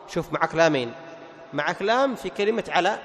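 ordinary talk

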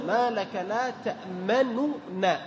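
تأمننا very slow, first nun with damma; original form. Shown as a demonstration. Teacher showing where the damma falls, very slow. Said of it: "النون الأولى".

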